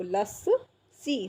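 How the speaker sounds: लस्सी drawn out slowly as las...see; पूरा स. Shown as a demonstration. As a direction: break लस्सी in two, lingering on each part. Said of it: "पूरा लिख के देखो". (लस्सी को धीरे से तोड़कर)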